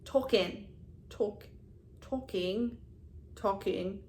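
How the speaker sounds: testing a New Jersey "talking", word by word; shaky grasp of a New Jersey accent; attempted New Jersey accent. Shown as a demonstration. Vlogger failing at her New Jersey accent, trying repeatedly. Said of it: "I thought I had a New Jerseyan accent".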